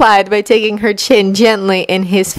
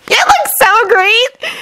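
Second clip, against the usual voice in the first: high, slow, gushing praise; beaming; slower than usual. A narrator's reading. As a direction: gush over the costume. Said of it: "she smiled broadly".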